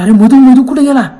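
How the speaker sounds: acted fearful shout; frightened cry. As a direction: call out in alarm for a missing friend. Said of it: "थरथर घाबरायला".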